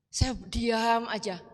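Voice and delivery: ordinary delivery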